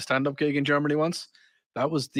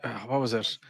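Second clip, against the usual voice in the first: ordinary delivery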